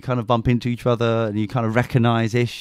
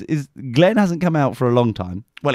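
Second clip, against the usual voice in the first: ordinary talk, nothing marked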